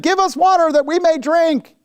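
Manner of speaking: high, pleading demand; quarreling crowd. Acted. People demanding water from Moses, high and quarrelsome. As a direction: beg for water like an angry mob. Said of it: "the people quarreled with Moses and said".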